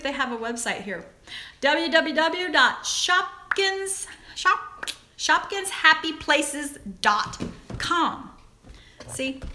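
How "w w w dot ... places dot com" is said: slow, careful web address; spelled out slowly. Shown as a demonstration. Vlogger telling viewers the website to order from, slowly. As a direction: read the address out for viewers to copy. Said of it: "where you can order the Shopkins".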